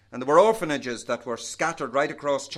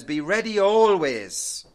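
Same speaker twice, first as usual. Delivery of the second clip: "always" long, high and loud; stress on always; 4 semitones higher, 6 dB louder, slower than usual. Real, not acted. Preacher quoting Peter's clear words, "always" loudly drawn out. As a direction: hit "always" hard and stretch it.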